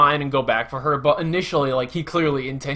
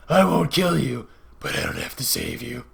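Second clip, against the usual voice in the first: put-on hero voice. Hero sparing a beaten enemy, put-on grave voice. Quoting a hero's moral choice.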